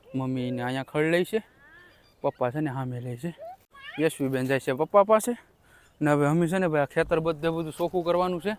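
usual speaking voice

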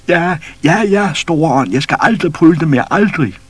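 high, loud, desperate begging; frightened pleading. A narrator's reading. Man begs the great spirit for mercy, terrified. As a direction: grovel and beg for mercy.

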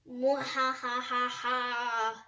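slow drawn-out evil cackle; villain laugh, stretched long; mad scientist laugh. Acted. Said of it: "I think I do it better than her".

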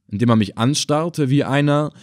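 ordinary delivery

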